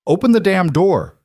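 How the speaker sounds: "Open the damn door!" yelled high and loud; shouting. Acted. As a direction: shout it over a roaring storm. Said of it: "she shouted".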